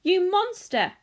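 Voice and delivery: high, loud accusation; furious shriek. A narrator's reading. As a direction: shriek the insult at him. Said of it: "she shrieked".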